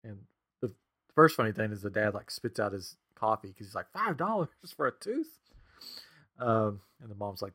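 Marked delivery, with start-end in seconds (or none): 3.9-5.4 mock shock. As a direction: retell a dad's disbelief in a raised pitch. Retold dad's shocked outburst.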